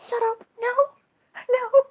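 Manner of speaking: high, loud "no" of disbelief; shocked disbelief. Real, not acted. Vlogger reacting to Jesus being a Pisces, shocked squeal. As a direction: squeal it like you can't believe it.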